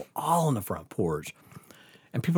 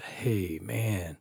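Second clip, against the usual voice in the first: low, soft retold alert; hushed warning; 5 semitones lower, 10 dB quieter than usual. Friend at the dark window warns him, hushed. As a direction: keep it down, mildly secretive. Retold lookout spots people outside.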